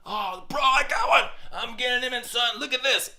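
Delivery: high, loud show of triumph; over-exaggerated excitement. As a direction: hype up the catch for your son. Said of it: "gotta be exciting, over-exaggerated".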